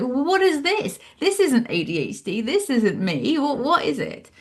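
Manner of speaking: high, puzzled questions, half-mock; retold bewilderment. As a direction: act baffled at your own behaviour. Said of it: "I was like".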